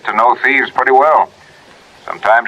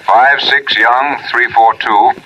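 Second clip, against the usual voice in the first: slow dictation; slower than usual. Acted. Reading plate numbers slowly.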